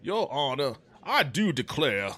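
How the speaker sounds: a Southern judge declaring loudly; Foghorn Leghorn. Retold or imitated. Streamer as judge, a loud hammed-up Southern drawl. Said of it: "I can't do a good foghorn leghorn".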